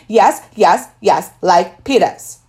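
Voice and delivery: put-on loud, slow insistent toddler yell; hollering. A narrator's reading. Toddler demanding loafers like his big brother, hollering. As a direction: demand it like a stubborn little kid. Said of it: "Fudge hollered".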